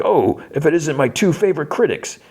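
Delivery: high, put-on hammy greeting; Sweet Tooth. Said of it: "Sweet Tooth comes up to him".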